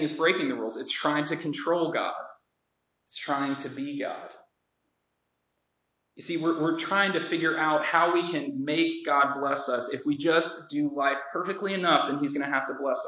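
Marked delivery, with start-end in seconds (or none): none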